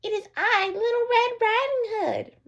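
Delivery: high, slow put-on girlish voice; a sly wolf pretending to be a little girl; wolf faking softness. A narrator's reading. Wolf at grandmother's door posing as the girl, high and slow. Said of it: "make his rough voice sound soft".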